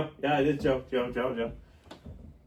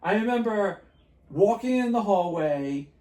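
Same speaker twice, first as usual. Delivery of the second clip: usual speaking voice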